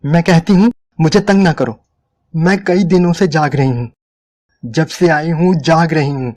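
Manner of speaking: exasperated. A narrator's reading. Angry exhausted woman pushes him away.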